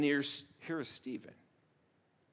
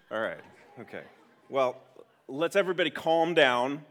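usual speaking voice

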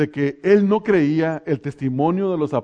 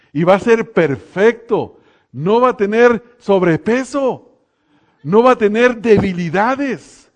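building to a louder, higher proclamation; emphatic preaching; 5 semitones higher, 5 dB louder than usual. Acted. Preacher promising the congregation a perfect body, emphatic preaching. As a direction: preach it with mounting force.

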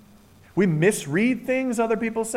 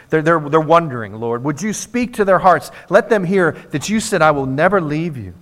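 louder, pleading prayer voice; fervent prayer; 5 semitones lower, 7 dB louder than usual. Acted. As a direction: pray out for the wanderers. Leads the closing prayer.

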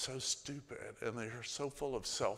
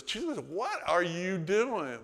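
higher, louder, slow indignant Martha; Martha scolding; 7 semitones higher, 7 dB louder, slower than usual. Retold or imitated. Martha chewing on Jesus at the tomb, higher and louder. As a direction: drag it out like a put-out sister. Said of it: "But Martha goes".

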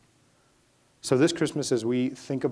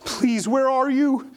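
higher, louder pleading prayer, slowing at the end; desperate plea; 9 semitones higher, 4 dB louder than usual. Acted. Voicing souls begging God to answer, a desperate high plea. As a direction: cry out to a silent God. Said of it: "prayers from our souls that say".

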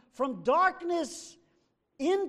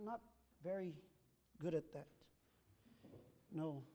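muttered admission; 9 semitones lower, 18 dB quieter, much faster than usual. Retold or imitated. Quiet aside voicing the believer.

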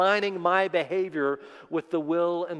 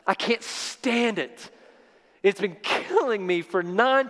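anguished confession; 6 semitones higher, slower than usual. Acted. David crying out over his sin.

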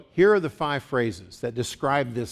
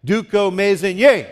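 "Duko, mez, en, ye" as one chant-like word, "ye" much louder; stress on last syllable; 3 semitones higher, 7 dB louder than usual. Shown as a demonstration. Preacher gets everyone saying it together, last syllable stressed hard. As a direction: lead Duko, mez, en, ye firmly, punching the final syllable. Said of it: "One more time. Together".

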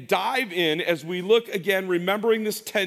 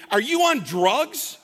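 high, slow, laughing "are you on drugs"; mock-incredulous laughing retort; 8 semitones higher, 3 dB louder, slower than usual. Shown as a demonstration. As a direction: play it as a disbelieving joke. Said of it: "the answer would be".

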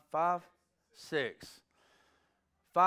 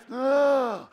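big gasp; 11 semitones higher, 11 dB louder than usual. Retold or imitated. Reliving child's first breath.

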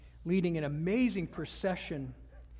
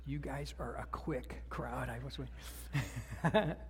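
teasing whisper; 6 semitones lower, 6 dB quieter, slower than usual. Real, not acted. Teasing aside to the congregation.

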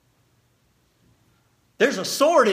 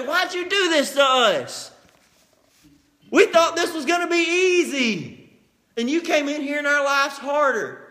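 complaining Israelites; 5 semitones higher than usual. Retold or imitated. Preacher voices Israelites griping at Moses, whining. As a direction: grumble at Moses like wronged slaves. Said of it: "These people say".

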